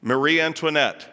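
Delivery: ordinary talk, nothing marked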